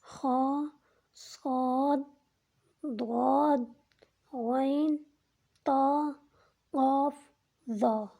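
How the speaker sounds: full-mouthed thick letters; heavy letters. Shown as a demonstration. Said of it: "موٹے پڑھنے والے حروف استعلا". (موٹا، پُر آواز میں)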